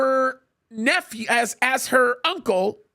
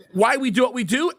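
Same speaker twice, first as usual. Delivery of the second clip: usual speaking voice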